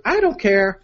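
lightly hammed girly voice. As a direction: lift it into a mock-girlish register. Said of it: "a girly voice for this part".

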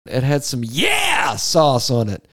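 loud, slow hammed-up shout. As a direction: belt it out theatrically.

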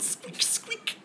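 tiny high whispered squeaks; very high, soft, breathless squeak-squeak; hamster squeak. Acted.

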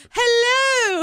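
shrill-high, shouted mock British greeting; hammed-up British phone voice, very high and loud. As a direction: holler it in an over-the-top British accent. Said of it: "with a very offensive British accent".

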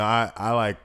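ordinary talk

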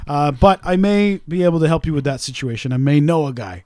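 ordinary delivery

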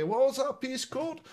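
high, curious lad voice. Retold or imitated. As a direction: ask it like an impressed schoolmate. Big lads asking about piece.

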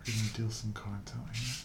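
soft ASMR murmur; quiet, gentle spoken voice. Shown as a demonstration. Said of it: "that's not a whisper to me".

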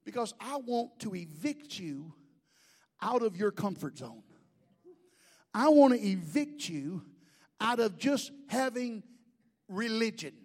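ordinary talk

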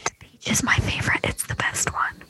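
hushed conspiratorial whisper. Real, not acted. Host whispering her favorite flavor back. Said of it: "Oh, whispering".

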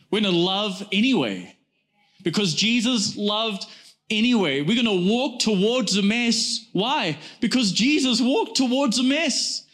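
rising preacher fervor. Acted. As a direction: preach it up to the peak. Preaching builds to climax.

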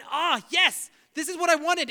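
a believer delighted God agreed; elated. Retold or imitated. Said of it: "We become elated".

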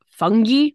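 fungi said slowly as FUN-ghee; local Irish pronunciation. Retold or imitated. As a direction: say fungi with a hard g and ee ending. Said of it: "they have a bay of".

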